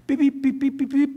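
high mock beeping; telegraph beeps. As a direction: tap it out like Morse code. Boss taps telegraph code.

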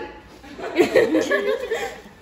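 high, bright giggle; high laugh. Real, not acted. Laughs about the smoky fire. (tiếng cười khúc khích cao)

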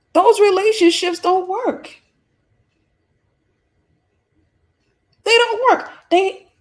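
high, loud preacher's cry; emphatic outburst. Real, not acted. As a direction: call it out loud and high, driving the point home. Breaks off skit with emphatic verdict.

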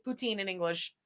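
put-on English "poutine", said "poo-TEEN"; "poutine" in an Anglophone accent, long ee. Shown as a demonstration. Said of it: "in all its Anglophone accent glory".

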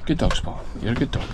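ordinary talk, nothing marked

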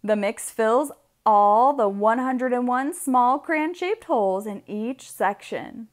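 usual speaking voice

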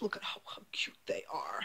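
hushed whisper over the dolls; soft unvoiced coo. Real, not acted. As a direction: whisper it as if not to wake them.

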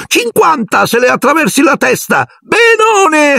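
a ghost puffing up and shrieking her bitter joke. Acted. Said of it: "Mirtilla si gonfiò tutta e strillò".

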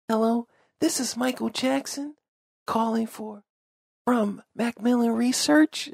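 high, airy [name] impression, far above his own voice; a playful [name] take on a phone greeting. Bored telemarketer greets a stranger in a [name] voice. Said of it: "I was doing a voice".

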